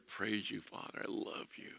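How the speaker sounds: soft, breathy whispered worship; heartfelt whisper. Shown as a demonstration. Preacher showing sincere worship to the congregation, whispered. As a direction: whisper it tenderly to God. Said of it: "That's from your heart".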